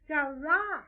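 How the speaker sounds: small toy bear growling a word. Acted. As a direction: let out a growled roar as a little bear. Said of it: "He growled at me".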